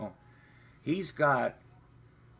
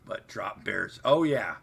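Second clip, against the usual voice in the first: usual speaking voice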